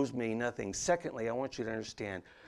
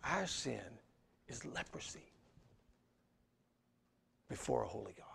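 "Our sin is leprosy before a holy God" dropped to a whisper, much softer; 10 dB quieter than usual. Acted. Pastor repeating the point to the congregation, hushed whisper. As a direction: hush it like a confession.

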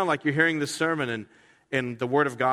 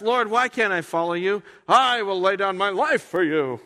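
bold Peter vow; 6 semitones higher, 5 dB louder than usual. Acted.

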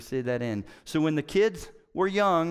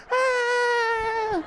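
long, very high mock shriek; hammed-up high wail; children's frightened scream; 4 dB louder than usual. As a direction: scream like kids fleeing. Kids shriek and flee inside.

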